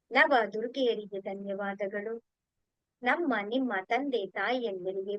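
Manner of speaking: slow, measured devotional recitation; unhurried litany of gratitude; prayer of thanks. Real, not acted. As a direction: recite each thanks reverently, endings drawn out.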